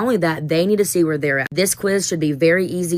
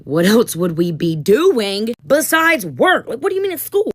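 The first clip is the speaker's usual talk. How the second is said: put-on high, whiny teen voice, much higher; mock student whine; 5 semitones higher, 4 dB louder, slower than usual. Teacher mimicking her students' whiny complaint. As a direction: mimic a kid whining about work.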